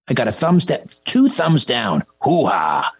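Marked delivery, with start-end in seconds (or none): 2.2-2.9 gravelly Hoo-ha bark, a bit lower; lightly done Pacino growl; Al Pacino impression. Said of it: "That's my Al Pacino".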